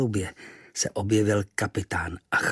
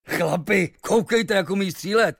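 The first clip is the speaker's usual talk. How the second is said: high, louder impressed exclamation; admiring sailor; 10 semitones higher, 4 dB louder than usual. Acted. A sailor admires Kvíkvék to the crew, high and louder. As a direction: marvel at a great shot.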